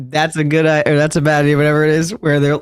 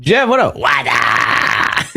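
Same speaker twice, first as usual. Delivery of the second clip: mock shouted greeting; 4 semitones lower, much slower than usual. Shouting greeting imitating Jeff.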